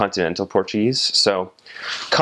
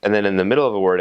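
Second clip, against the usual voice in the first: normal talking voice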